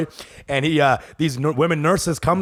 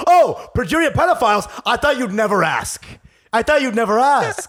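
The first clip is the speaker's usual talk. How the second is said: hammed-up eager reply; 7 semitones higher than usual. Eager comic character voice.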